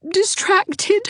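mildly tearful, pitch climbing high; a touch of tears rising in the voice. A narrator's reading. Dovey begging Anne to decide for her, mildly tearful. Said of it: "Dovey's voice broke on a tearful note".

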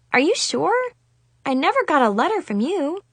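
slightly confused. A narrator's reading. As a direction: question it, a little baffled. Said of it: "slightly confused".

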